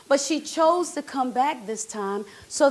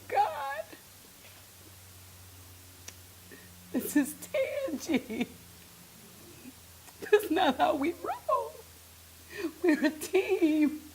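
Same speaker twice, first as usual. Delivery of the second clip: higher voice, begging God not to do this; pleading with God; 6 semitones higher, 7 dB quieter than usual. Retold or imitated. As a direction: plead your case to God, hurt and urgent. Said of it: "I was like".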